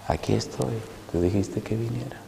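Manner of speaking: lightly voiced tender call; soft tender voice. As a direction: speak softly like a loving presence. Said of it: "esa voz tan rica".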